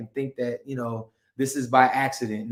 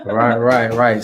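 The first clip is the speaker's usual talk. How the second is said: laughing; 4 semitones lower, 9 dB louder, slower than usual. Real, not acted. Host laughing at guest's joke.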